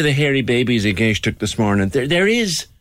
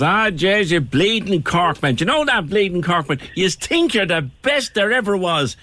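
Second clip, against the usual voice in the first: high-pitched Dub having a go; riled Dubliner; 9 semitones higher than usual. Acted. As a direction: play an exasperated Dubliner goading a Cork man. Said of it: "the minute the dub heard the Cork accent".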